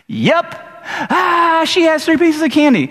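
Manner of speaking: put-on high, insistent kid whine; whining child. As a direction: sulk it out like a stubborn child. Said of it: "They go".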